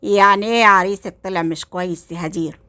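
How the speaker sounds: ordinary delivery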